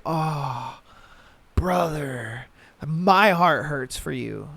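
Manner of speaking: mock sympathy. Man watching another guy hurt, soft pained sympathy. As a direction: wince it out for the poor guy. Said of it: "you're just like".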